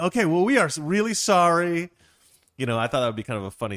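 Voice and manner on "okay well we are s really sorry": high, loud acted apology, slowing at the end; emphatic apology. Couple apologises to friend after the fight, emphatic. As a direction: apologise loudly, dragging out the last words.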